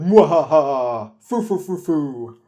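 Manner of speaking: slow, loud villain cackle; hammy mad scientist laugh; evil laugh. Retold or imitated. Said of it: "the classic bad guy thing".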